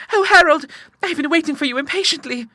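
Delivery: put-on very high-pitched young woman's cry; eager Clara. A narrator's reading. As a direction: greet him impatiently in falsetto. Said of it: "she cried".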